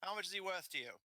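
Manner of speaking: high-pitched bandit question; haggling bandit. Acted. Bandit to Lucien about the boy, haggling. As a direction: size up the deal as the bandit.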